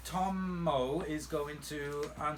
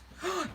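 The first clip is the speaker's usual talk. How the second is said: sudden gasp. Real, not acted. Sudden idea about razors.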